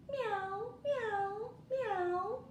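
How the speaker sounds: high rising meows; cat meowing. Acted. Cat arriving to help, high rising meow.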